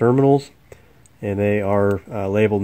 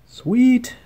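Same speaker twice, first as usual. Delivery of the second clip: excited; 14 semitones higher than usual. Real, not acted. Fix works.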